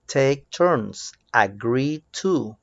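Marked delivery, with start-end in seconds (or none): none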